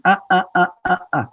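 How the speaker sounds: high, halting warning sounds; imitated inner alarm, stuttered out. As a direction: cut in with a string of warning uh-uhs. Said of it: "warning you and saying".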